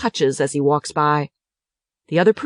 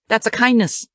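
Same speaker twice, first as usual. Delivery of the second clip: plain reading voice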